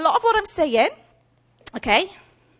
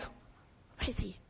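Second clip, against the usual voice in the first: slow hushed whisper; whispered question; 3 semitones lower, 16 dB quieter, much slower than usual. Acted. Barak and Deborah searching for Sisera, slow whisper. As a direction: breathe it as a secret search.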